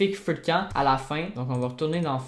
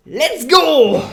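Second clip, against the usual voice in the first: shouted high and loud, thrilled; excited cheer; 11 semitones higher, 12 dB louder than usual. Real, not acted. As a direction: cheer like you just nailed it. Joie après un effet réussi. (cri de joie aigu et fort)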